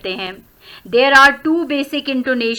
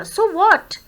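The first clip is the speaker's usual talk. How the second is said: "so what" pitched high, leaning on "what"; rising intonation; 6 semitones higher than usual. Shown as a demonstration. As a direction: lift the voice sharply on "so what". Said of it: "this is rising intonation". ("so what" ऊँचे, उठते सुर में)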